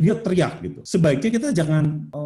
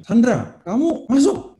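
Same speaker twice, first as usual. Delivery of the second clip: high, raised imitation of a mother; acted motherly command, high and raised; ordering mother; 8 semitones higher, 4 dB louder, slower than usual. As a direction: call the child in, pitched up. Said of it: "Ibunya ngomong sama dia".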